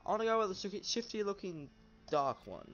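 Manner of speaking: high, loud eager pick; excited. Real, not acted. Gamer picks the shifty dark horse, eager and loud. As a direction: blurt out the choice gleefully.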